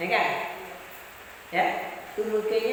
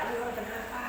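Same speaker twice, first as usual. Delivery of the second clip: soft quote; 9 dB quieter, faster than usual. Retold or imitated. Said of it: "Jadi orang marah-marah".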